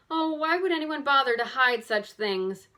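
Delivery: let-down exclaim. A narrator's reading. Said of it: "Bess exclaimed, completely let down".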